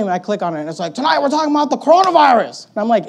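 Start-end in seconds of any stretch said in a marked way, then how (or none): 0.9-2.7 high, hyped-up streamer parody; mock excitement, pitched up; mock announcement. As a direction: imitate an over-eager live-stream host. Imitating live streamers' announcements.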